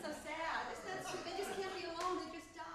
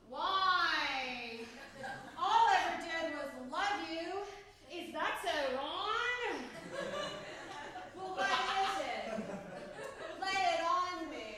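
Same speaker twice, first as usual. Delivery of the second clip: slow plea rising to loud protest; melodramatic jilted lover; 2 semitones higher, 7 dB louder, slower than usual. Acted. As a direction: play an overwrought abandoned spouse. Monologue, being left by lover.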